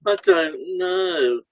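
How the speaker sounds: slow, drawn-out reluctant I don't know; stuck client. Retold or imitated. Stuck client dodging the therapist's question, slow and reluctant. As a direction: mimic a client who won't engage, slowly. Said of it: "people who say".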